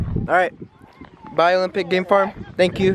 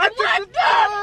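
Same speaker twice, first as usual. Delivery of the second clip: excited shout. Real, not acted. Animal reaches car, excited cry.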